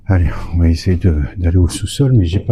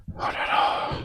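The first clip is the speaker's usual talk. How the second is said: whispering; 9 dB quieter, slower than usual. Real, not acted. Tense exploration, whispered exclamation.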